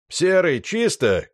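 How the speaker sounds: громкий высокий крик напарнику; крикни снаружи, что чисто. Acted. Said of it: "крикнул Славян". (громкий крик)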